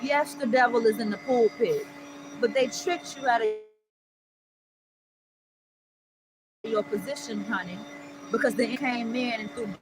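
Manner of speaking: preacher calling out the devil; preaching. Real, not acted. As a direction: testify with full fervor. Preaching to listeners.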